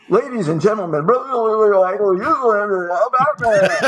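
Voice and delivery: high, booming mock PA announcer; stadium announcer. Guest imitating the stadium PA before his first pitch, hammed-up announcer. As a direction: ham up the ballpark announcer over the speakers. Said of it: "the next thing I hear is over the, uh, the system".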